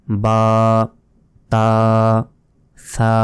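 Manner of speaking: বা, তা, সা each with a held long vowel; letter recitation, সা as tongue-out "thaa"; one-second stretch. Shown as a demonstration. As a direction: recite বা, তা, সা separately, each drawn out evenly. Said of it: "এক second টেনে পড়তে হবে".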